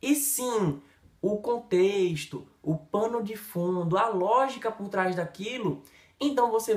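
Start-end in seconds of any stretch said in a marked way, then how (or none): none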